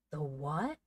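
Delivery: a mildly incredulous question; surprised exclamation. A narrator's reading. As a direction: ask it in mild disbelief. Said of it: "exclaimed Joel".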